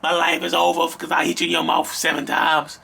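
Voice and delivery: mock pleading abuser voice; hammed-up begging, please please. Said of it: "if you let them talk you out of it".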